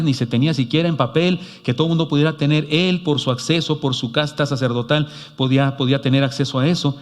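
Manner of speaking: ordinary talk, nothing marked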